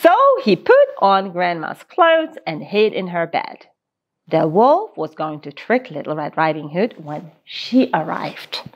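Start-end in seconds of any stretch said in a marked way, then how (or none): none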